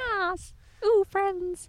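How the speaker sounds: high, playful squeal; hammed-up gushing over friends; mock delight. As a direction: coo it in a high put-on voice. Joins the gushing play voice.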